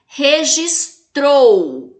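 "Registrou" very slow, final "trou" long and strong; stressed last syllable. Shown as a demonstration. Teacher asking class for the strongest syllable, "trou" stressed in "Registrou". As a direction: break up "Registrou" and push hard on "trou". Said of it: "Qual que é a sílaba com maior intensidade?".

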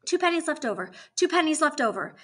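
lightly voiced stubborn insistence; insistent repeating. A narrator's reading. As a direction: repeat it in the same set tune. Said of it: "like someone is arguing with her math".

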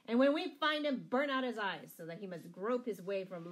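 loud, high, furious threat; angry sheriff. A narrator's reading. Sheriff threatening the outlaw leader, angrily shouting. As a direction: burst out in rage. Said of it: "red with anger".

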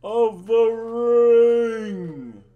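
loud, high, drawn-out ring announcer; ring announcer call. Acted. Vlogger announcing the tournament, booming ring announcer. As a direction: bellow the title like a ring announcer. Announcer style build to title.

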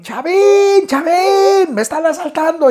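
high desperate yell for the brother; shouting to his brother Chavín, a high panicked yell; shouting for help. Retold or imitated. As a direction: call out in a panic up the stairs. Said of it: "empiezo a gritarle a mi hermano".

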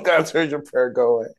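a hammed-up, bored shooing away; mock dismissive priest. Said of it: "Eli is basically like".

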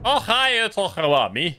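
put-on Scottish accent starting high, then dropping; attempted Scottish accent. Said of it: "Scottish accent".

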